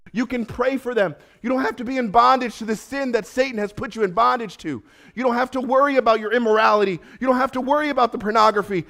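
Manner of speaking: high, loud, impassioned preaching; preaching at full force. Acted. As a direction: plead with them, full of fire.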